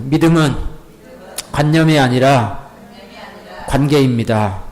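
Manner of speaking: slow, measured lines for the congregation to repeat; slow repeat-after-me. Shown as a demonstration. 회중에게 따라하라고 천천히 또박또박. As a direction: lead the call, slowly, with pauses. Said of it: "따라해보세요".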